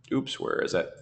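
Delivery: ordinary talk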